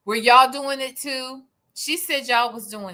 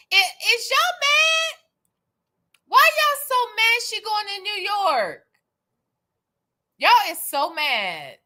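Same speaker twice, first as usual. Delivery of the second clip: mock incredulous teasing; 10 semitones higher than usual. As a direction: tease them with exaggerated high-pitched disbelief. Teasing viewers for being mad.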